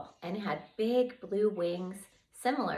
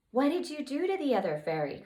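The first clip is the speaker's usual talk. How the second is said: eager question; 8 semitones higher, faster than usual. Acted.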